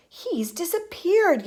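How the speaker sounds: high, drawn-out alarm; yelling. A narrator's reading. Mother losing sight of her son, yelling. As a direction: cry it out slowly in alarm. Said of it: "yelled Missus Gloop".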